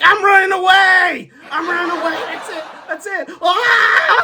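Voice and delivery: high, loud overacted shrieking; mock hysterical panic. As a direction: shriek as if fleeing for your life. Boss flees the moving car.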